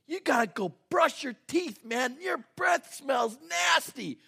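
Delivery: hammed-up grossed-out parent; mock disgusted scolding. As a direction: nag the kids, recoiling in disgust. Acts out scolding his kids.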